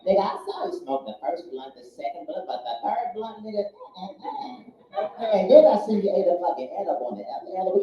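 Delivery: a hint of Cat Williams as the talking dog; the dog sassing its stoned owner; lightly voiced Cat Williams dog. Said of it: "My dog sound like Cat fucking Williams".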